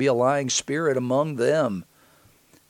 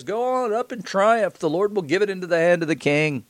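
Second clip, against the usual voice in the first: drip sarcasm as you repeat their promise; sarcastic; 6 semitones higher than usual. Acted. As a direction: Micaiah mockingly parroting the false prophets to the king, sarcastic.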